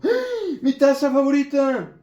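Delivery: loud, high cry over a broken cup; dismayed shout. Acted. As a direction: wail over the broken mug. Cup breaks mid class. (grito de disgusto)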